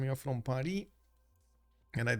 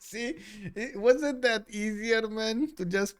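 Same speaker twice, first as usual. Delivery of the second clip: talking through real laughter, higher and louder; real laughing rant about the letter O; 6 semitones higher, 4 dB louder than usual. Real, not acted.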